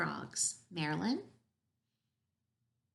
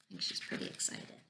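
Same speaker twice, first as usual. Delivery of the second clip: whisper; 8 dB quieter than usual. Real, not acted. Reader aside, unvoiced.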